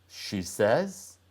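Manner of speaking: usual speaking voice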